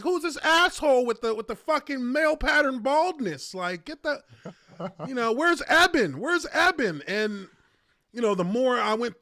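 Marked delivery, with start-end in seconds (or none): 0.0-7.0 mock outraged fans. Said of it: "Everybody in the comment section is like".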